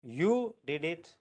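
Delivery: YOU drawn out, then a small break; slowly single out the word "you"; stress on YOU. Shown as a demonstration. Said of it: "emphasis that I am giving".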